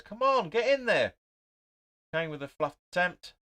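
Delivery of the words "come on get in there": loud, high, slow drawn-out shout; exasperated fan screaming at the team; frustrated yell. Real, not acted. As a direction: bellow it at the telly in frustration. Frustrated at players standing around.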